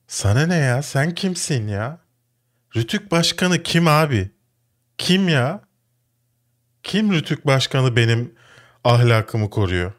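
high, indignant challenge; real irritation. Real, not acted. Host challenges the regulator's chief, really irritated. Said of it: "Gerçekten çok sinirleniyorum".

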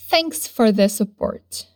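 slow, stress on thanks; slow drill. Shown as a demonstration. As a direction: say it slowly for them to repeat. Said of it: "Repete comigo".

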